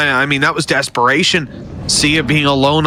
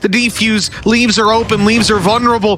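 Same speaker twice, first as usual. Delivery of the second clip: high, building hype play-by-play; esports caster; 9 semitones higher than usual. Real, not acted. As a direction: ride the tension of the clutch. Clutch round called live.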